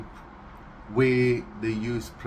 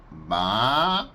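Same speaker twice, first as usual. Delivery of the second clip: louder baa bleat; sheep bleat; 2 semitones lower, 3 dB louder than usual. Shown as a demonstration.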